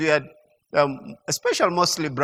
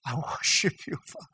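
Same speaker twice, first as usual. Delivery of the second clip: soft, hushed prayer; quiet worship; 5 semitones lower, 11 dB quieter than usual. Shown as a demonstration. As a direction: murmur it intimately to God. Models quiet, intimate prayer.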